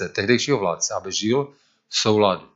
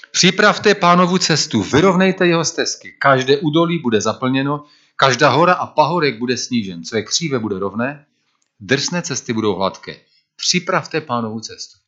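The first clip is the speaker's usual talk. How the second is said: proclaiming scripture; 4 semitones higher than usual. Acted. Preacher proclaims the prophet's call to the congregation, raised and ringing. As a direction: proclaim it like a herald.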